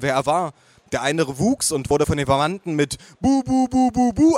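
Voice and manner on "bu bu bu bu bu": high, loud chant; bellowed baby talk. Acted. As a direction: blare baby syllables on one high note. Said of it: "angebrüllt".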